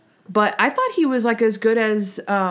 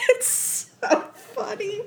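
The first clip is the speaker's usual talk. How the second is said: higher, slow, laughing through the words; 13 semitones higher, 4 dB quieter, much slower than usual. Real, not acted. Host laughing about Natalie's face, words broken by laughter. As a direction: giggle it out.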